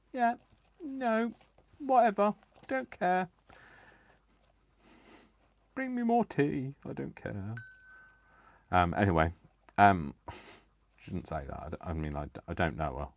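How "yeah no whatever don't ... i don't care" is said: high, bored, dismissive royal voice; bored queen. Retold or imitated. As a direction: wave it all away like a queen who couldn't care less. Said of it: "she just kind of, like, says".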